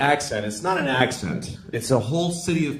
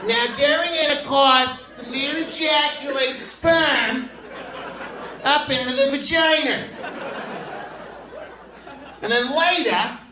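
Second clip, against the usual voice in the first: much higher, slow prim teacher voice; fifth-grade teacher explaining sex ed, prim and high; mocking the Boston teacher; 13 semitones higher than usual. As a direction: lecture the class like a stiff old schoolmarm. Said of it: "She's like".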